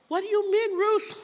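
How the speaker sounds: high, mock-puzzled question; incredulous question. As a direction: pretend to be baffled by her. Someone questioning the speaker.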